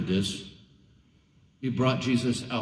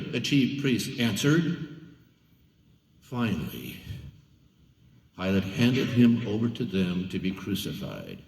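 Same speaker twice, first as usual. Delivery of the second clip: plain reading voice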